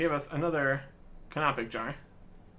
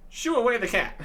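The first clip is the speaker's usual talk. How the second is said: dramatic exclamation; 7 semitones higher, 5 dB louder than usual. Acted. Reading choice loudly.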